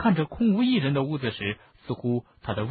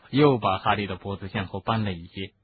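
ordinary delivery, nothing marked

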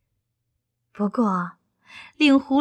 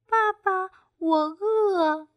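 high little duckling voice, pleading; hungry baby duckling asking; 9 semitones higher, much slower than usual. A narrator's reading. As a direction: ask papa for food like a tiny duckling. Duckling asks for food.

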